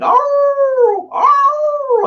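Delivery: high, loud hammed-up dog howl "arr, arr"; mock dog howl. Telling a sales story, he mimics the dog's howl: mock high howl. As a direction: howl like a hurt dog, playing it up. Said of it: "the dog's just howling".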